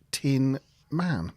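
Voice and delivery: ordinary talk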